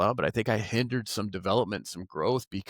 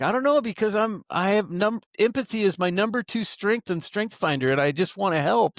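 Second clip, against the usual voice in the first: flustered reply; 9 semitones higher than usual. Retold or imitated. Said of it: "I'm like".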